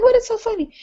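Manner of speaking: high, baffled ask; puzzled question. Retold or imitated. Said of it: "I was like".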